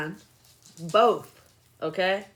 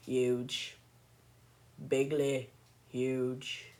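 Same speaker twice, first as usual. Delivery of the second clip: Donald Trump; 4 semitones lower, 4 dB quieter, much slower than usual. Retold or imitated. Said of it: "I can't really do a Donald Trump impression".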